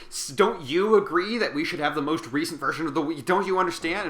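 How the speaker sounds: insistent schemer demanding support; pushy insistence. Retold or imitated. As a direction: lean on him hard, voice up. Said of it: "is like".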